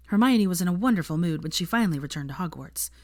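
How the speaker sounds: ordinary delivery, nothing marked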